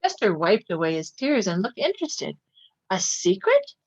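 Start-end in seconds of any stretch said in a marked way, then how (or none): 2.9-3.8 high, bright squeak of curiosity; curious surprise. A narrator's reading. Raccoon child perking up at a secret, high surprised question. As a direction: perk up, much higher.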